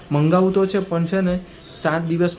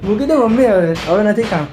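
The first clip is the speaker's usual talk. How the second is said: laughing order; 7 semitones higher, 6 dB louder than usual. Real, not acted. Laughing, telling mom to put it away.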